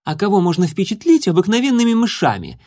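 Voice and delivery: high, sharp retort; snapping back. A narrator's reading. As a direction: snap back at her rival. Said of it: "огрызнулась Клариса".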